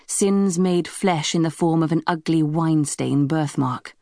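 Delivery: ordinary delivery, nothing marked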